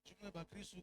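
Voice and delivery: soft, low-pitched preaching. Shown as a demonstration. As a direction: drop to a quiet, gentle speaking voice. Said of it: "kama mtu anasema pole pole". (kwa sauti ya chini, pole pole)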